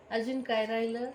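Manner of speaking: normal talking voice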